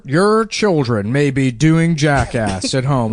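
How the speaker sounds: slow, measured mock newscaster; ominous TV news warning to parents; drawn-out, emphatic anchor delivery; local news anchor. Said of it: "watching some local news thing".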